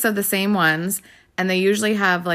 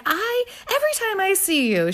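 much higher, starstruck gushing; gushing lady; 14 semitones higher than usual. Retold or imitated. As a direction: gush like a delighted stranger. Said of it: "She's like".

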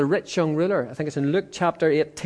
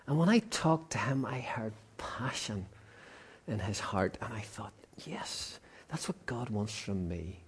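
soft, breathy whisper of a private thought; whispered inner realisation; hushed whisper; 7 semitones lower, 14 dB quieter than usual. Real, not acted. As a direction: lean in and whisper it like a secret prayer.

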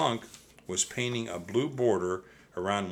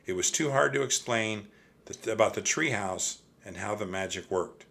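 ordinary delivery, nothing marked

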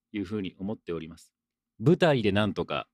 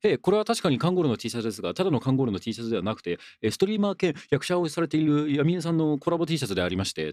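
higher, fast-running polite product explanation; put-on courteous presenter patter, pitched up; formal explanatory tone; 6 semitones higher, faster than usual. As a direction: recite a mock-official explanation of the shirt. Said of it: "必ずこの口調でお願いします".

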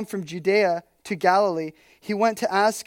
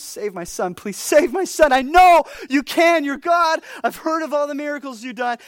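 pleading, rising into a louder cry; loud desperate begging; 6 semitones higher, 6 dB louder than usual. Retold or imitated. Father crying out to Jesus to save his son, loud pleading. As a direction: beg louder and louder for your son. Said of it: "begging Jesus".

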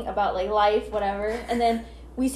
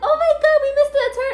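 high, loud mock-panic squeal; panicked screaming; 5 dB louder, faster than usual. Acting out screaming after the missed turn, high squeal. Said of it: "we're screaming in the car".

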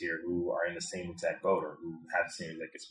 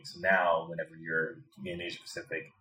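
ordinary delivery

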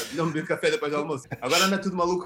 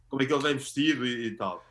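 ordinary talk, nothing marked

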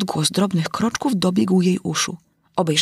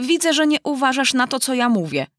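stern; 6 semitones higher than usual. A narrator's reading. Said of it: "rzekła mysz surowo do Alinki".

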